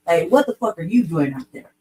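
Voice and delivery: ordinary delivery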